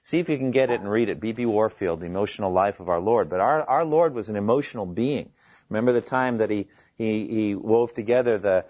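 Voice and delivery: ordinary talk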